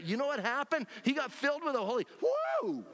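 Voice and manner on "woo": a high shout of joy; joyful high whoop. Real, not acted. Preacher celebrates Saul being filled, high woo. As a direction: let out an excited woo. Exclaims about Holy Spirit filling.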